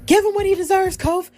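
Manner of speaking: high-pitched retold cheer, urging him on; imitating Xavier cheering. Xavier urging Kofi from ringside, high mock cheer. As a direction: pitch way up like a teammate egging Kofi on. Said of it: "you could hear Xavier saying".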